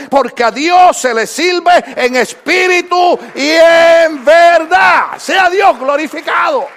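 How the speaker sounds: a preacher shouting to the congregation; full-force preaching. Acted. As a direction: preach it out loud and slow.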